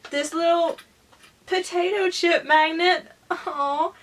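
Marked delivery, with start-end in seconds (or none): none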